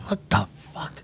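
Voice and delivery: low, whispered mock bewilderment; retold whisper of disbelief. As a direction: whisper it to yourself, baffled. Retold baffled reaction to the cop.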